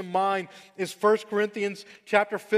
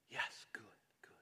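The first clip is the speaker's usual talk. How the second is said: whispered praise; 7 semitones lower, 18 dB quieter than usual. Real, not acted. Praising a four-year-old.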